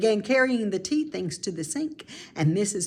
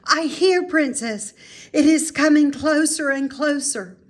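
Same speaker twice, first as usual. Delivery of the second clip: higher, louder playful gasp of fear; excited pretend fear; 7 semitones higher, 6 dB louder than usual. Mary Lou answering in the witch game, excited mock fear. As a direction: play scared and thrilled.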